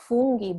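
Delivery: funghi with "oo", "FOON-ghee"; Italian U. Shown as a demonstration. Teacher repeats correct funghi with OO. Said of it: "because the U is pronounced".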